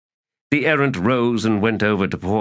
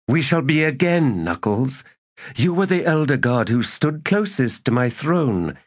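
a lightly voiced errant, higher and insistent; scheming elder god; 8 semitones higher than usual. A narrator's reading. Errant to Knuckles, lightly voiced and insistent. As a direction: coax an old ally back to power. Errant urges old ally.

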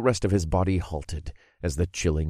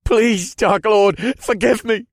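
desperate begging; 6 dB louder than usual. Acted. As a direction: beg for mercy. Captive begging.

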